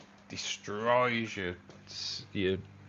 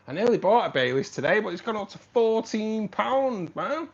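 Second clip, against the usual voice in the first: indignant about price; 11 semitones higher, 9 dB louder than usual. Real, not acted. Price of Baileys went up.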